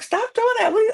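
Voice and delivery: very high, loud mock scolding. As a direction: snap at him to stop. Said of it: "she was like".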